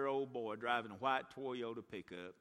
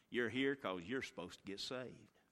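normal talking voice

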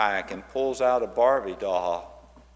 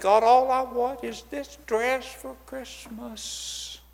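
put-on child's prayer; 11 semitones higher, slower than usual. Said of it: "she's been praying to God".

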